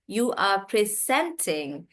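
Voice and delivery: "presenting" slow, middle syllable stressed; stress on SENT. Shown as a demonstration. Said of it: "the stress is on sent".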